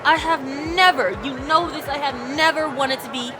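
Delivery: panicked rant. Acted. Continued panicked outburst at Sid.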